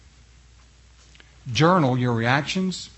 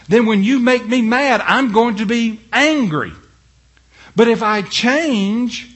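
louder, higher, driving sermon voice; preaching at full force; 9 semitones higher, 6 dB louder than usual. Acted. Pastor to his flock, preaching at full force, louder and higher. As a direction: preach it hard to the church.

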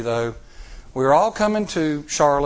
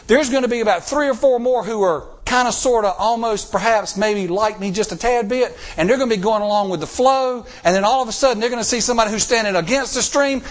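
raised, driving sermon voice; preaching; 4 semitones higher than usual. Acted. Preacher challenging the congregation to stand firm, fervent preaching. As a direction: press the congregation hard on the point.

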